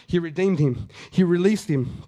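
ordinary delivery

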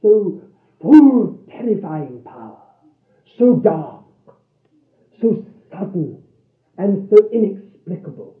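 loud, emphatic sermon delivery in short phrases; impassioned preaching. Real, not acted.

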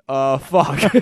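loud startled curse; mock panic. Prank caller caught out on the phone, loud blurted curse.

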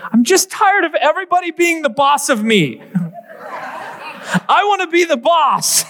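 put-on high, childish whining voice; child's whine. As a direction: pitch it way up like a fed-up little boy. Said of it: "And he said".

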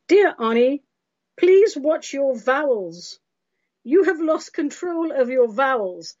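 high, slow, emphatic scolding read; prim listener's letter. Acted. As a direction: read it as a stern, fussy reprimand. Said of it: "This is from Sandy".

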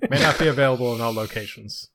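loud announcer disclaimer. Pretend. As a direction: read the fine print aloud. Mock ad disclaimer.

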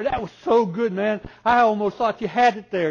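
loud, high praise shouted; cheering yell. Retold or imitated. Grandma praising the grandson who missed, loud cheering. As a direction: cheer him on like a proud grandma. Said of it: "she's screaming".

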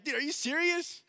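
high, alarmed incredulous yell; loud disbelief. Retold or imitated. Passenger hearing his pilot passes out in clouds, loud alarmed disbelief. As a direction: shout it in mock-horrified disbelief.

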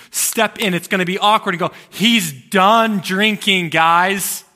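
loud, high preacher's shout; building to a drawn-out, full-voiced yell; urgent calling out. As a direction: shout it out to step in. Voicing Jesus urging him to intervene.

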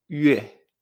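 yue said "yüe", French U gliding into E; ue con diéresis. Shown as a demonstration. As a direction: round the lips for Ü in yue. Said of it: "se van a pronunciar". (yue con U francesa)

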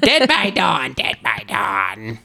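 second line drops low and slow into a rasp; Deadite voice. Retold or imitated. Host mocking an actress's voice as a Deadite, creepy rasp. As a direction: croak it out like a possessed Deadite from Evil Dead. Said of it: "sounds like a fucking Deadite".

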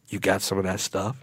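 hushed, low undertone; furtive ask. Retold or imitated. Covertly asking for drugs.

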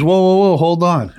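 high, loud "hold on" interjection; urgent halting call. Retold or imitated. Car dealer stopping the seller as he leaves, urgent call.

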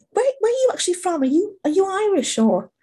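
high, quick mock curiosity; puzzled questioner. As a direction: imitate someone probing where an accent is from. Nosy people asking about her accent.

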